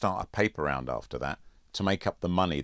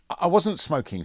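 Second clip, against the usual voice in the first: stammering protest; 12 semitones higher, 7 dB louder than usual. A narrator's reading. Boy protests innocence to teacher.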